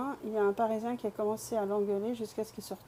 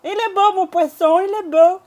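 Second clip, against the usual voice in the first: loud, high street-seller call; playful belted sales pitch; fishmonger's cry; 9 semitones higher, 13 dB louder than usual. Pretend. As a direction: hawk your fish to the whole market.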